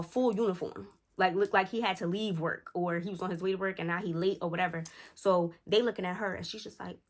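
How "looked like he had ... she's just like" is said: ordinary talk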